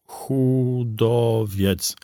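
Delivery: slow, syllable by syllable, "Chudowiec" read off a screen; spelling out syllables. Acted. Sergeant reading the driver's name off his screen, syllable by syllable. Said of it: "przesylabizował nazwisko z ekranu".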